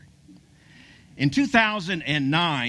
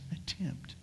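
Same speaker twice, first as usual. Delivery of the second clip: whispered; 2 semitones higher, 15 dB quieter than usual. Acted. Preacher to congregation, awed by the stats, whispered. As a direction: whisper the word in awe. Building awe over the stats.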